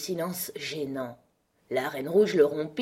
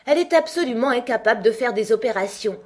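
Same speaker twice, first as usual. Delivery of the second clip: quick, raised, emphatic verdict; loud exclamation; 7 semitones higher, 8 dB louder, faster than usual. A narrator's reading. Both queens judge Alice at once, in a loud voice. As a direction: pronounce it fast, loud and high. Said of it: "s'exclamèrent les deux reines en même temps d'une voix forte".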